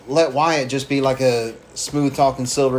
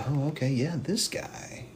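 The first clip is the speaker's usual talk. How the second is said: softer, breathy going-along; whispered agreement; 10 dB quieter than usual. Retold or imitated. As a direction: murmur it as you give in. Cult members falling in line.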